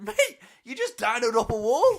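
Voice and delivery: high, loud laughing reply; mock disbelief through laughter; laughing retort. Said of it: "I was like".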